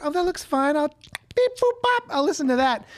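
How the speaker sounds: high-pitched playful voice; chirpy and goofy, pitch way up; silly high voice. Retold or imitated. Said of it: "And so I was on Audible and I said".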